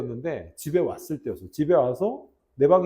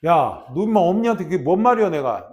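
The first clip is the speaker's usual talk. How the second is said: loud, slightly raised big-brother telling-off; lightly played stern older brother; mock scolding; 3 semitones higher, 7 dB louder than usual. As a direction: act out scolding a younger sister. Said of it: "막 뭐라고 지랄을 했지".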